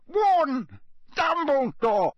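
very high, loud, slow cry; desperate warning; dying man crying a warning; urgent warning. A narrator's reading.

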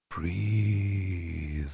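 very soft, far-off, smooth; distant silken voice. Acted. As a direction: breathe it from far away. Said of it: "distant, silken voice".

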